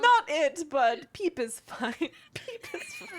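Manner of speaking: high voice cracking into laughter; laughing through the character. Acted. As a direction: try to stay in character and fail laughing. GM voicing healer, laughing.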